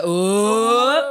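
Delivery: long, loud, high rising "oh"; astonished gasp. Retold or imitated. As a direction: re-enact amazement with a drawn-out swooping "ohhh". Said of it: "the first one I remember was just like".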